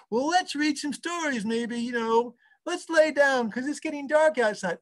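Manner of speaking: put-on high, slow sing-song voice coaxing a toddler to sleep; bedtime story voice. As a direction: read it sweetly, slowly, like tucking in a small child. Parent coaxing toddler to bed.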